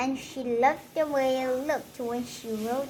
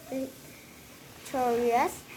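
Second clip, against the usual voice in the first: teacher's slip; 3 dB quieter, much slower than usual. Acted. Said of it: "said Miss Chud".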